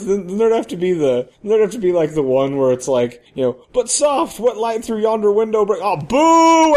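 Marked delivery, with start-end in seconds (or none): 3.7-5.8 high, lofty stage voice, lightly hammed; mock Romeo recital. Stripper reciting Romeo to the club, hammed up. 5.8-6.7 loud, high boo; mock heckle. A heckler booing the stripper's Shakespeare, loud.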